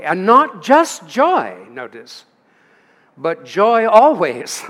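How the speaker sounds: slow, loud, stressing "always"; emphatic preaching. Acted. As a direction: proclaim it, each word pressed down. Preacher driving home joy always.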